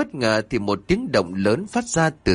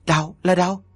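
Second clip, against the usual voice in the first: high, sudden alarmed cry of his wife's name; husband jolted by a scream from the bedroom, crying out; startled cry; 8 semitones higher than usual. Acted. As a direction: blurt it out in fright. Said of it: "Anh Kiểm thốt lên".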